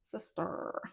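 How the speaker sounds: soft whispered repeat. Acted.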